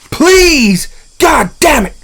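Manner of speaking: loud pleading yell that turns into a curse; desperate angry shout. Retold or imitated. Frustrated tech yelling at the locked computer, loud pleading curse. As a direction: beg the screen, then swear at it loudly.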